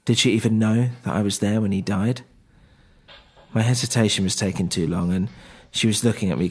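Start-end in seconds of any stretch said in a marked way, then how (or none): none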